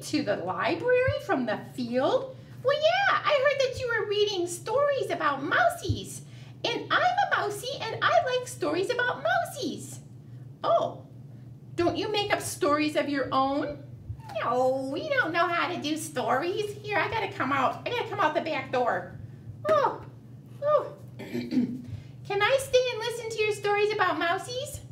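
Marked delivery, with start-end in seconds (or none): 2.6-11.0 very high cheerful squeak; a tiny friendly mouse; squeaky mouse voice. Acted. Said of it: "Miss Mousy". 14.4-20.9 squeaky mouse voice. Acted. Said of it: "Miss Mousy". 21.2-24.8 high little mouse asking; a shy mouse pleading; squeaky mouse voice. Acted. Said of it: "Miss Mousy".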